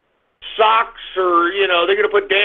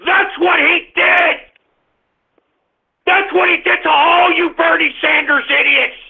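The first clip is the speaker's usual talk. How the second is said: furious shout; 8 semitones higher than usual. Real, not acted. Ranting host yelling at Bernie Sanders supporters, furious shout. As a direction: let it rip, raging.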